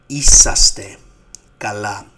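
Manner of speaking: "Είσαστε" projected loudly; loud model word. Shown as a demonstration. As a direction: belt out "Είσαστε" for the class. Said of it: "You will say".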